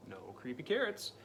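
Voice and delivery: ordinary delivery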